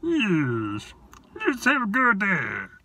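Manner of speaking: high, loud, slow creature king; Snoswatch King voice. Acted. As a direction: announce yourself grandly in a high creature voice. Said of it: "said the king".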